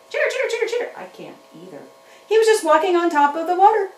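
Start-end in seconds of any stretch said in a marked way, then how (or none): 0.1-0.9 high, chirpy critter squeak; squeaky puppet chatter. Pretend. Puppet chatters at the children, high and squeaky. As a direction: chatter like a small excited puppet animal.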